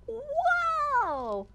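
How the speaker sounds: high, delighted exclamation; excited wow. Acted. Mouse seeing the painting back on the easel, high delight. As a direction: marvel at the painting.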